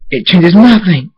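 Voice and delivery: high, loud, drawn-out outburst; angry shout. A narrator's reading. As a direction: shout it slowly in anger. Thunderstorm angrily rejects the apology.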